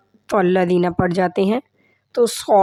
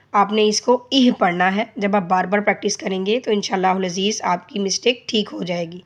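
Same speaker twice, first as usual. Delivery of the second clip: usual speaking voice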